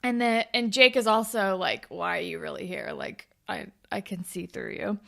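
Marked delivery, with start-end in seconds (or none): 1.9-5.0 softly voiced wary quote; quiet suspicion. Jake questioning why she is really here, quietly suspicious. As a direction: say it low-key, like you doubt them.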